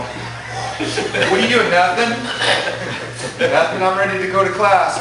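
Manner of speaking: high, loud, hurried-innocent cover-up; acting caught out and overly ready; mock nervous denial. Nervous student caught huffing in the parking lot, mock innocent.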